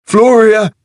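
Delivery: piercing high, loud scream of a name; shrieked. A narrator's reading. A young man on the platform shrieks for Floria, high and loud. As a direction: scream her name in panic. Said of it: "shrieked a stocky young man".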